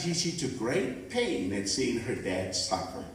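ordinary talk, nothing marked

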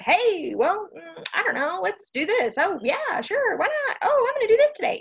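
high, breezy, offhand shrug of a voice; mock casual God. Preacher mocking a careless, random God, high breezy voice. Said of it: "He's not just".

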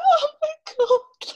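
high, loud, slow squeal while laughing; laughing oh my God. Retold or imitated. Runner spotting her idol mid-race, squealing and laughing. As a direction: squeal with delighted disbelief. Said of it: "and I'm like".

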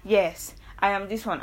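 ordinary talk, nothing marked

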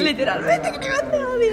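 laughing. Real, not acted. Joking about hate crime.

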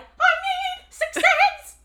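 very high, loud, slow belt; shouted stage line. Retold or imitated. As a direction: belt it over the music. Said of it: "And so it was like".

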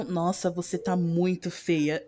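low, soft, slow remark; contrasting low intonation. Shown as a demonstration. Showing the same sentence with another intonation, low and subdued. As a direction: drop the voice and drag it out, understated. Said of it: "Ou então".

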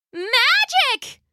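very high, thrilled exclamation; high-pitched burst of wonder; crying out. Acted. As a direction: cry it out in amazed delight. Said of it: "she cried".

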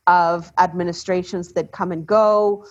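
normal talking voice

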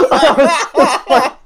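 helpless laughter breaking up the words; high, slow, laughing through it; laughing while talking. Real, not acted. Cracking up while telling the story.

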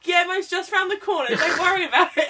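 high, laughing coaxing voice, sweet-talking a victim; coaxing lure. Retold or imitated. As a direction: coax him in with a high, giggly sell. Luring Doug with a wig.

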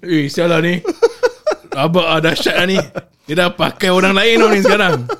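laughing through the words; words breaking into giggles; trying not to laugh. Real, not acted. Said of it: "I'm not laughing, I'm not laughing".

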